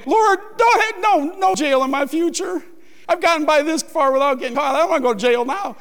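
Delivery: high, fast, pleading to God; a hammed-up panicky plea; frantic prayer. Preacher mimicking his own desperate jail prayer, high and fast. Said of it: "I was praying".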